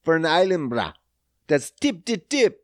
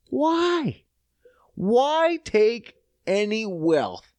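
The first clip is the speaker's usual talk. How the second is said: incredulous; 8 semitones higher, much slower than usual. Real, not acted. Incredulous rant.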